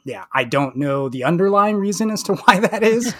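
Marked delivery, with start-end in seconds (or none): 1.2-3.1 laughing through the words; a chuckle breaks into the line. Real, not acted. Laughs while speaking.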